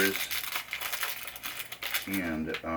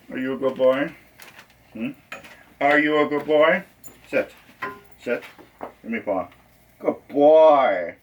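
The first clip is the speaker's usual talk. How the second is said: talking to a dog; 6 semitones higher, 3 dB louder than usual. Real, not acted.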